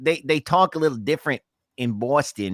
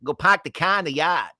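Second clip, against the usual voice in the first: higher-pitched, playful Boston accent; 6 semitones higher, 3 dB louder than usual. Shown as a demonstration. Co-host repeats the practice phrase for fun, Boston accent. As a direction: drop the r's in a broad Boston accent. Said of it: "to get in my Boston accent".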